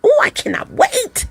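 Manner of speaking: slow swooping high excitement; high excited swoon. Real, not acted. Vlogger gushing to viewers about moving. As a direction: sigh it up high with longing. Eager about her move.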